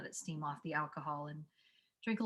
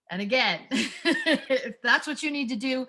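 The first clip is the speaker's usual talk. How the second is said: laughing; 6 semitones higher, 7 dB louder than usual. Real, not acted. Laughing after her scream demo.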